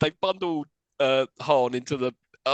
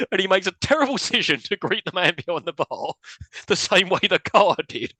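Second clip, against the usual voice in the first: ordinary delivery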